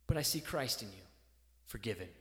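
low and soft, fading; as God, quietly forgiving; soft low murmur of forgiveness; tender divine reassurance. Pretend.

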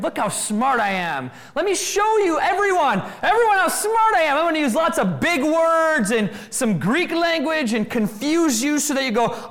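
high, loud puffed-up bragging; mock boastful show-off. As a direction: lord it over the room like a know-it-all. Mocking intellectual boasting.

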